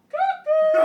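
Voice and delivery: high, loud falsetto cuckoo; hammed-up birdlike falsetto shout; mock cuckoo call. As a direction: squawk it like a cuckoo clock to mock him. Mocking opponent as crazy.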